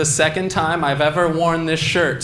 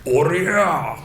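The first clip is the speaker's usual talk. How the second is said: short hammed-up fighting-game yell; a hint of an old martial artist's cry; Heihachi battle cry. Comic doing Heihachi, a lightly voiced shout. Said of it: "this is my impression of Heihachi from Tekken".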